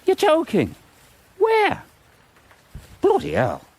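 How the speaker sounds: startled yelp; shocked. Acted. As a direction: squeak of surprise. Shocked news about Margaret.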